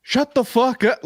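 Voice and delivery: the thought he swallowed, spat out high; inner fury. Retold or imitated. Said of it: "And I'm thinking".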